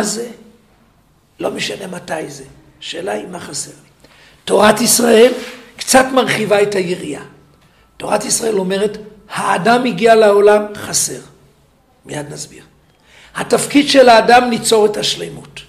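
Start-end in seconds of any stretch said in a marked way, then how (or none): 4.4-11.2 full-force preaching. Acted. 13.3-15.6 full-force preaching. Acted.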